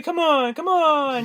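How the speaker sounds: high coaxing come on, lightly voiced; urging baby to get in the truck; coaxing boyfriend. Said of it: "He's like".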